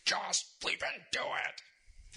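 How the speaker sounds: slow, strained mock roar, no real volume; drawn-out hoarse imitation of yelling; retold angry yell. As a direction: imitate a furious boss, word by word. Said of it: "he yelled back at me".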